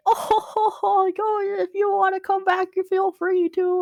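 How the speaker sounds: high-pitched, chuckling farewell; jolly uncle. Acted. Uncle waving the party off, high jolly farewell. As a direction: chuckle up high, then stretch out the invitation. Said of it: "And he's like".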